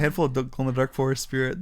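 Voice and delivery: ordinary talk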